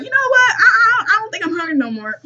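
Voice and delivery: high, loud, stammering comic nervousness; backpedalling dog. Retold or imitated. Dog excusing itself from eating Ellie, comic backpedalling. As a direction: laughingly voice a dog losing its nerve. Voices the fleeing dog backing out.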